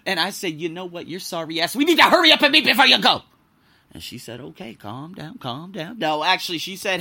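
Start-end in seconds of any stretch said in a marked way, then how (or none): 0.6-3.2 building to a fast, high yell; mock tirade that explodes into a shout; cussing her out. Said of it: "And I cussed her out". 4.4-6.0 softly voiced, placating friend; calming someone down. Friend soothing his retold tirade.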